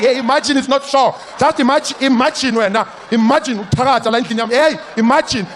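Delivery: high, loud mocking scold; mock the boy's imaginary friend; grandma shouting down the invisible friend.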